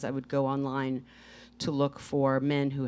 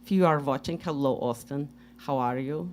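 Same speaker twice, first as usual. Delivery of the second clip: put-on light Russian accent, broken English; 3 semitones higher than usual. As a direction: tell the story in a Russian accent. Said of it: "do a Russian accent".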